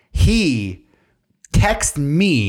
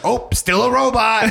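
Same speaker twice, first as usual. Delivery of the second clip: high robot operator; 10 semitones higher than usual. Pretend.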